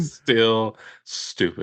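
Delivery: laughing through the words, slowed down. Real, not acted. Teasing a friend about his dumb friends, laughing. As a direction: giggle it out slowly.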